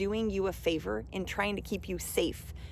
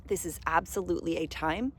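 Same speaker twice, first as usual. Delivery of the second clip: ordinary talk, nothing marked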